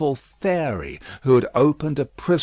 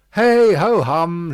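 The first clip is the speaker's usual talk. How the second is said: slow, louder hey ho hum; cheerful sigh; 7 semitones higher, 9 dB louder than usual. A narrator's reading. Miss Pross hiding her worry from Lucy, slow cheerful sigh. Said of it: "cheerfully repressing a sigh".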